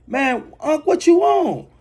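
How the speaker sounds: yelling at his uncle in disbelief; startled outcry. Retold or imitated. After uncle hits him with the bat, a shocked shout.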